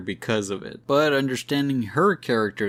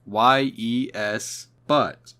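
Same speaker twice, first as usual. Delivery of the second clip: Y E S spelled out letter by letter, slow and louder; all caps yes; 4 dB louder, much slower than usual. Retold or imitated. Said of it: "all caps".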